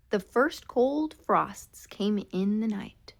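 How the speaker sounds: ordinary delivery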